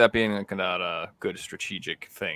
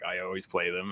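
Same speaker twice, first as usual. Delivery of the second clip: ordinary talk, nothing marked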